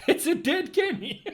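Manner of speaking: high squeak through laughter; laughing. Real, not acted. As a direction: laugh the words out. Cracking up over the trophy.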